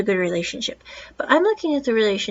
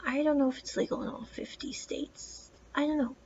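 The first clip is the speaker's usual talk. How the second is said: whispered, a little higher, doubting herself; unsure whisper; 4 semitones higher, 11 dB quieter than usual. Real, not acted. Reviewer doubting her own claim about laws, whispering. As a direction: hush it like a private doubt.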